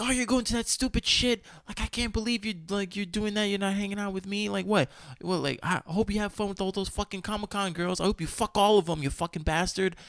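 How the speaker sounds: high-pitched mocking rant as the girlfriend; mocking angry girlfriend. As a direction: mimic her ranting at him, pitched well up. Girlfriend's rant voiced in story.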